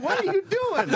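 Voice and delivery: very high, laughing mock protest; retold outraged question. Comic retelling the moment of being smacked, very high mock outrage. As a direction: squeal it as an imitated protest.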